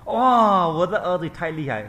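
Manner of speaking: high, loud cry of awe; mother praising her son; thrilled mother. Acted. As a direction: burst out in admiration.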